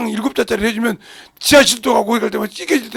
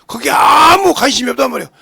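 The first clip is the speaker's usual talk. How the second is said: loud, forceful preaching exclamation; full-voiced pulpit cry; preaching shout; 3 semitones higher, 7 dB louder than usual. Real, not acted. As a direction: drive the point home with a shout.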